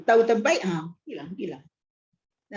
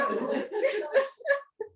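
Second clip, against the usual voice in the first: high soft laugh; 10 semitones higher, 5 dB quieter than usual. Real, not acted. Preacher laughs again.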